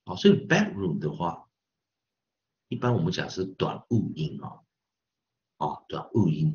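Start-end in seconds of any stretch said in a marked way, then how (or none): none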